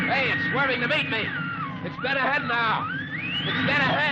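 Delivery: alarmed pilot. Acted. Pilot meets unknown object.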